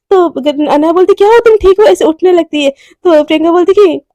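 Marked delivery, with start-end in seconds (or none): 1.1-1.9 higher-pitched startled check on a friend; alarmed concern. Acted. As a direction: ask in mild alarm.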